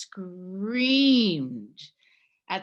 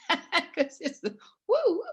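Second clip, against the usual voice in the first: high, hammed-up tourist whoop; mock cheering woo; 11 semitones higher, 3 dB louder than usual. Mocking Santiago as Disneyland for pilgrims with a high whoop.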